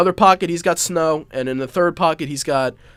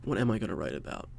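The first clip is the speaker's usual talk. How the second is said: much softer muttered repeat; muttered to himself; 5 semitones lower, 12 dB quieter, faster than usual. Real, not acted. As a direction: mutter the question under your breath. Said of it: "this is a constant debate with me".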